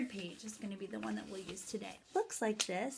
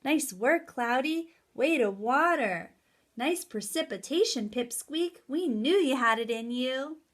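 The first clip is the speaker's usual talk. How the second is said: a hint of proud bosses cheering, louder; lightly voiced praising bosses; 2 semitones higher, 9 dB louder than usual. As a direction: cheer the little cloud on. The higher-ups praise the cloud.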